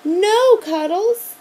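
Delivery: loud, high call to the dog; shouted. A narrator's reading. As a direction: yell after the runaway dog. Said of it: "shouted Principal Renfield".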